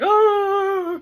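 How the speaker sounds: high, loud drawn-out "oh" sob; crying wail. Retold or imitated. Imitating sister crying as she sees the body, loud wail.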